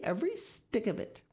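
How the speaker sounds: lightly proud emphasis; proudly. A narrator's reading. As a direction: add it with mild satisfaction. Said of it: "Mister Egg said proudly".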